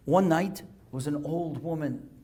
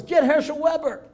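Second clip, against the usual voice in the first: high-pitched urgent call; mock yell from a bystander; imitated yell; 10 semitones higher, 5 dB louder than usual. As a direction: call out for help, moderately raised. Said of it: "And somebody yells".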